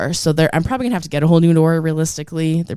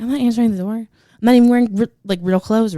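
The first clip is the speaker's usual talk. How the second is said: panicked refusal; 7 semitones higher, 4 dB louder, faster than usual. Retold or imitated. Panicked inner thought at door.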